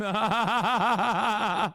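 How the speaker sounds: a high, shouted burst of laughter; shrieking laugh. Real, not acted. Streamer reacting to a pundit on CNN, shrieking laugh.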